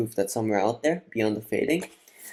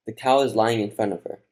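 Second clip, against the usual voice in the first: usual speaking voice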